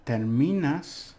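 "terminas" said very slowly, the middle syllable "mi" stressed. Shown as a demonstration. Teacher showing students which syllable of "terminas" to stress. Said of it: "remember the ... syllable we accent".

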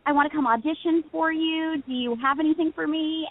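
put-on high, eager little girl on the phone; chirpy young caller; eager kid voice. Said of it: "I called and I said".

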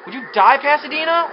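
loud, high-pitched exasperated demand; exasperated outburst. Real, not acted. Player yelling at a rival in a race, exasperated. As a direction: snap loudly at a stubborn opponent.

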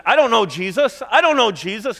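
high, loud panicked denial; Peter denying. Retold or imitated. Peter denying Jesus to his accusers, loud and panicked. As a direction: deny him in fear. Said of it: "when he said".

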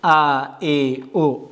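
stretched ا ای او; teacher chaining ا ای او; slow vowel drill. Shown as a demonstration. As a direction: draw out each vowel.

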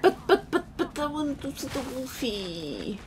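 put-on high, whiny stammer of a kid begging; whiny childish. Streamer whining like a child because he wants to see the wolf. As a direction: whine like a child denied a treat.

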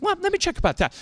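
very high-pitched take-off of a therapist; mock chirpy therapist. Therapist brushing off the complaint about noise next door, mock high voice. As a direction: put on a squeaky, high therapist voice.

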